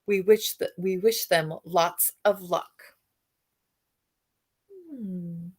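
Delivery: ordinary delivery, nothing marked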